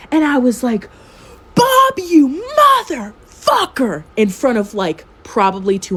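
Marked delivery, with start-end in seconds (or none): 1.5-4.0 high, loud reenacted outburst; retold furious yell. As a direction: yell it out, stretching the curse word. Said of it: "he's never heard me yell".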